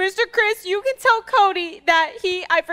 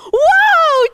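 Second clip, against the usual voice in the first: high, loud gasp of wonder; amazed whoa; 10 semitones higher, 7 dB louder than usual. Acted. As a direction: wow at the giant piano. Discovers the floor piano.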